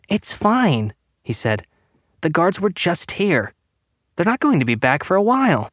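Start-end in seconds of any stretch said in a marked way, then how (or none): 0.1-0.9 put-on high-pitched boy's voice; young boy reassuring. A narrator's reading. Boy soothing his panicking mother. 2.2-5.7 put-on high, pleading boy's voice; young boy protesting. A narrator's reading. Boy arguing with his frightened mother.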